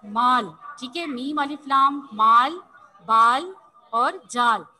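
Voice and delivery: rhyming words drawn out slowly; slow rhyme demo. Shown as a demonstration. Teacher showing rhyming words to pupils, slow and clear. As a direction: stretch each rhyming word for the class. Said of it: "मैं आपको वैसे verbally बता रही हूँ".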